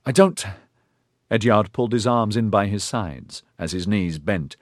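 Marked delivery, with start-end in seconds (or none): 0.0-0.5 worried. Acted. Worried character voices.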